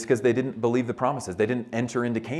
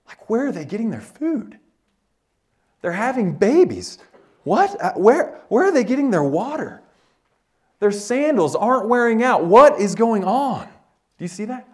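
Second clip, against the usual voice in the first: higher, louder baffled questions; shocked disbelief; 10 semitones higher, 6 dB louder than usual. Pretend. Preacher voicing the Israelites' amazed enemies, shocked and higher. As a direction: play the stunned enemy, mock bewildered. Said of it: "imagine how shocked you would be".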